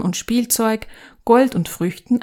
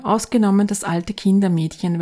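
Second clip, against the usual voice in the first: ordinary delivery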